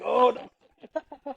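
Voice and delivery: high, thin retold "uh-oh" moment; mock dismay. Storyteller realising he insulted the real coach, mock dismay. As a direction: replay sinking embarrassment for laughs.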